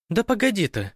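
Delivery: high-pitched impatient cry; shouted. A narrator's reading. Irina to departing Zoika, crying out to stop her. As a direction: call after someone walking off. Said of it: "крикнула Ирина".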